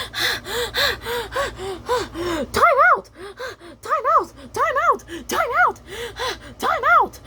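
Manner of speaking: very high repeated squeal; squeaky plea. Acted. Cat squealing high for a time out in the fight. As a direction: beg for a break, high. Delilah exhausted mid fight.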